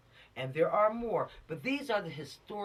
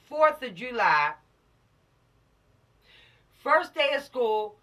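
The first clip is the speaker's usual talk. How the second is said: loud, high, drawn-out list of holidays; emphatic roll call; 5 semitones higher, 9 dB louder, slower than usual. Real, not acted. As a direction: call out each occasion slowly and forcefully. Loudly listing the holidays one would miss.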